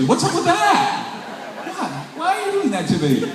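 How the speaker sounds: high, laughing, mock-hurt protest; laughing complaint. To his girlfriend laughing in the stands, high laughing protest. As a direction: whine it through a laugh.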